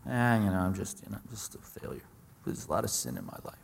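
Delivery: low, fading, near-whispered confession of failure; ashamed Peter. Retold or imitated. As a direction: shrink away, voice dropping to almost nothing. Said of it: "Kicks the ground".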